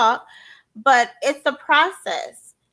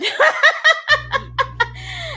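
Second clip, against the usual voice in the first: a high burst of laughter; sheepish high laugh; 13 semitones higher than usual. Real, not acted. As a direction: laugh it off at yourself. Laughs at herself.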